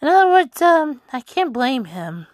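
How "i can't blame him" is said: normal talking voice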